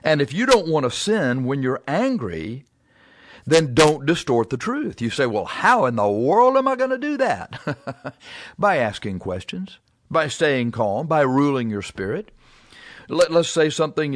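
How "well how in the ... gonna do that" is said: high-pitched, hammed-up bafflement; mock exasperation. Listener objecting to advice on not distorting truth, mock exasperation.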